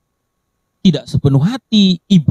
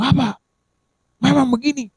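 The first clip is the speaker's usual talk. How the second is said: high, soft-edged mock snap; snapping hard-headed person; 9 semitones higher, 5 dB quieter than usual. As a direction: play the stubborn one brushing advice off. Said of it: "Ada orang yang kalau ngomong". (nada orang keras kepala yang membantah)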